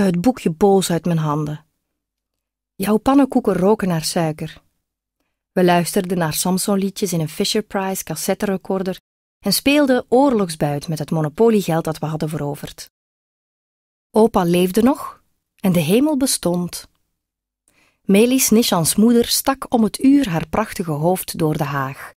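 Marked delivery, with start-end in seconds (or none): none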